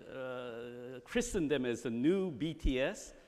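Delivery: ordinary delivery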